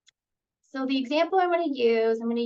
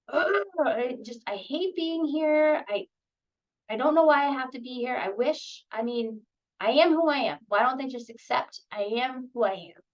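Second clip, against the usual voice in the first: put-on frustrated kid. Kid in therapy venting that nobody accepts them. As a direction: vent like a kid who feels judged.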